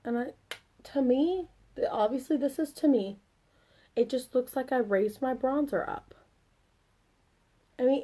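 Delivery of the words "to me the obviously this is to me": ordinary talk, nothing marked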